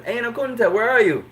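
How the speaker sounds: lightly voiced teacher scolding a late student. Teacher to a late student, mock scolding question. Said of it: "the teacher says".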